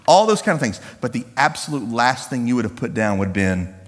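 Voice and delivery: ordinary talk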